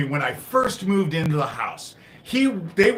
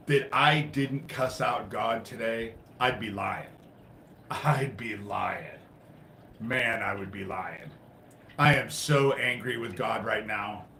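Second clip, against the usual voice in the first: low confession; 5 semitones lower, 6 dB quieter than usual. Real, not acted. Confessing anger at God.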